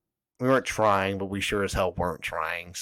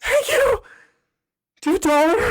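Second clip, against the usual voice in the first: suspicious squeak; 10 dB louder, slower than usual. Retold or imitated. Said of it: "don't be suspicious".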